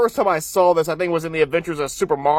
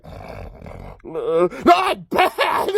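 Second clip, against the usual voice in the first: hammed-up incredulous commentator squawk; imitating stunned announcers; 3 semitones lower, much slower than usual. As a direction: shoot the pitch way up on the echo. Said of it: "the two announcers like".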